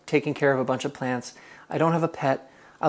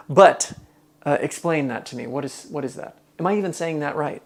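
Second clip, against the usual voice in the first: usual speaking voice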